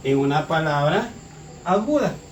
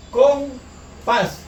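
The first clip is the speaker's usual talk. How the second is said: "Compás" broken in two, higher, with a pause after "com"; separado en sílabas; 5 semitones higher than usual. Shown as a demonstration. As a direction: say "Compás" syllable by syllable: com, pás. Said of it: "¿Dónde lleva la mayor fuerza de voz?". ("Compás" en dos sílabas separadas: com, pás)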